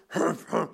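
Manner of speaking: two short snorts through the nose; imitated bull snort. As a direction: snort like an angry cartoon bull. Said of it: "they're like snorting smoke out of its nose".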